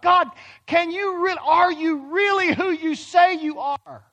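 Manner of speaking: high, loud anguished plea to God; doubting prayer. Pretend. A doubting believer cries out to God, high and loud. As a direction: beg God for an answer.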